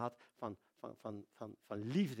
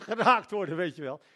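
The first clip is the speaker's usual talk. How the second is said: laughing through "geraakt worden", louder; 4 semitones higher, 12 dB louder than usual. Retold or imitated. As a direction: chuckle at the naive hope as you repeat "geraakt worden". Laughing at Art's naive hope.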